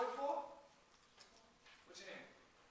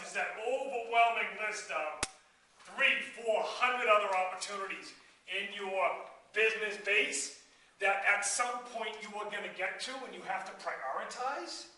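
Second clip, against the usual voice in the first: ordinary talk, nothing marked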